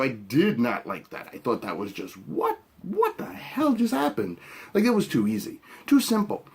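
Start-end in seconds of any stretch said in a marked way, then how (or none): 2.3-4.4 incredulous. Real, not acted. Incredulous reaction.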